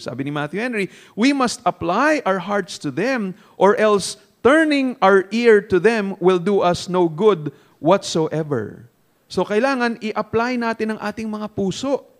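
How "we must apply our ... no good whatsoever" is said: slow, weighted sermon reading; emphatic reading. Acted. Preacher quoting commentary on applying the heart, slow emphatic reading. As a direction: read it out slowly, leaning on key words. Reads commentary aloud to congregation.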